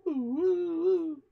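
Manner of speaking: playful curveball sound effect; imitating a curveball. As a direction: mimic the ball's swerve with a rising woo. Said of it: "it did that kinda like".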